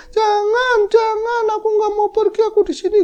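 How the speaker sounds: very high, loud wailing plea; high-pitched mock weeping; imitated crying. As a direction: imitate a ghostly woman sobbing and begging. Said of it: "Dia nangis seperti itu".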